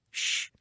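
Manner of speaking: soft shush. Acted. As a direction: hush him sharply. Cabe hushes.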